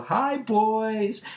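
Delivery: high singsong falsetto hello; coy put-on woman's coo; little greeting. Elastigirl waves at the boys, high and sweet. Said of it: "waves at them with a little".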